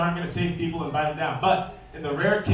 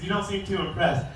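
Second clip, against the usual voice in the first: ordinary talk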